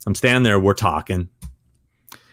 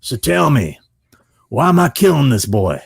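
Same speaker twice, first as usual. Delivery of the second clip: raised, louder actor impression; imitated in-character actor, pitched up; Robert Patrick; 5 semitones higher, 4 dB louder than usual. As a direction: challenge the writer as a demanding actor. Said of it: "and he goes".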